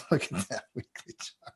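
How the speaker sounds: amused, words breaking into laughter; laughing. Real, not acted. Cracking up over a bad chart.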